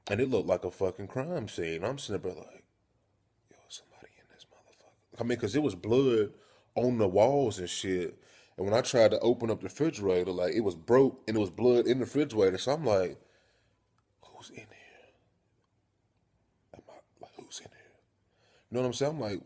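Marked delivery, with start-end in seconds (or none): 3.5-5.2 hushed, fearful whisper; acted whisper of alarm; scared whisper. Retold entering a crime scene. 14.3-17.9 barely audible, cautious whisper; acted nervous whisper; scared whisper. Retold finding blood at home.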